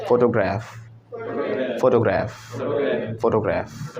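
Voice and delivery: "फोटोग्राफ" as FO-to-graef, falling at the end; corrected pronunciation. Shown as a demonstration. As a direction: say "फोटोग्राफ" with graef, not graaf. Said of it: "पहले तो ग्राफ नहीं".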